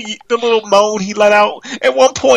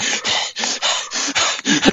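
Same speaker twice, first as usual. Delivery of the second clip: mock panting breath; 6 dB quieter than usual. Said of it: "trying to catch his breath".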